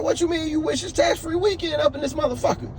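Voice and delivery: can't believe what the clerk said, voice jumping up; indignant disbelief. Retold or imitated. Arguing with clerk over tax.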